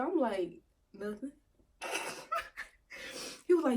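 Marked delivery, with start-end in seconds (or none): 1.0-1.3 small, very soft answer; sheepish denial. Retold or imitated. Girlfriend answering her boyfriend's accusation, sheepish. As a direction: say it meekly, much softer.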